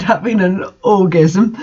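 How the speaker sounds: ordinary talk, nothing marked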